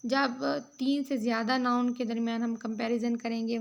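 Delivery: ordinary talk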